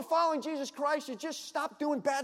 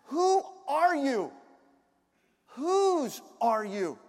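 slow rhetorical questions; 3 semitones higher, 5 dB louder, much slower than usual. Real, not acted.

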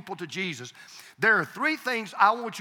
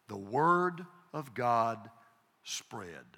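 very slow and low, dropping away to a hush; low, weighty, drawn-out key line; slow, solemn emphasis; 9 semitones lower, 6 dB quieter, much slower than usual. Real, not acted. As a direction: let each word land, sinking softer to the last. Slow solemn delivery of the key point.

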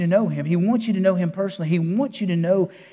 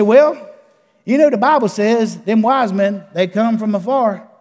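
put-on East Texas boy voice, a bit higher; East Texas accent; 3 semitones higher than usual. Preacher quotes the boy explaining his picture, East Texas accent. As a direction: play the little boy with an East Texas twang. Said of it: "in that East Texas accent".

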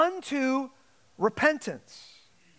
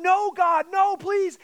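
mock pleading; 6 semitones higher, 3 dB louder, slower than usual. Sinners weeping before God.

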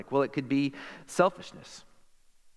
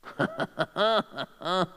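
a sudden, strange-sounding laugh that surprises even him; rising laugh that breaks out unexpectedly; odd laugh; 4 semitones higher than usual. Real, not acted. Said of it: "Don't know how I laugh like that".

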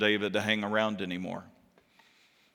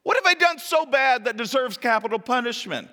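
desperate exclamation; 11 semitones higher, 5 dB louder than usual. Acted. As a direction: plead your innocence, pitched way up. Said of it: "David exclaimed".

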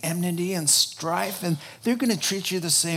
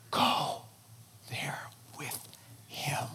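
softer, breathy whisper; 2 semitones lower, 11 dB quieter, slower than usual. Acted. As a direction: drop to a bare whisper. Solemn hushed call.

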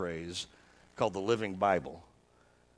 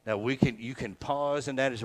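usual speaking voice

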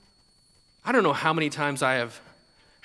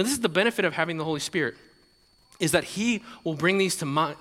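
ordinary delivery

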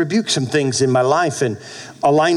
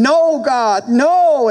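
a higher, raised protest; pleading cry; 11 semitones higher, 4 dB louder, much slower than usual. Retold or imitated. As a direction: cry out no like a stubborn child. He refuses, crying out.

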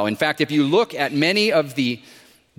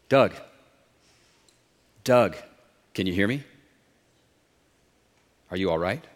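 calm voice from above; 6 semitones lower than usual. A narrator's reading. Unseen Lord calls down to stranded climber, low calm voice.

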